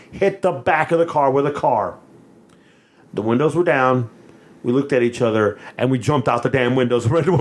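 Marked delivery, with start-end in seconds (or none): none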